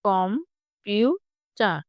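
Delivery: কম-পিউ-চার in three slow, separate beats; split into syllables. Shown as a demonstration. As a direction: pause after each part of কম-পিউ-চার. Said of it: "তিনটি syllable". (কম-পিউ-চার তিন ভাগে ভেঙে ধীরে)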